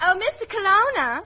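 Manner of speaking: put-on Southern accent. Secretary getting the studio boss's attention, in a Southern accent. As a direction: call out sweetly high in a Southern accent. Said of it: "could have sworn you came from Boston".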